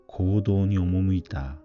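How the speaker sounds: ordinary delivery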